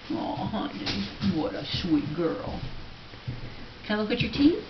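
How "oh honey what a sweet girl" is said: normal talking voice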